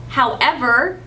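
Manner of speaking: normal talking voice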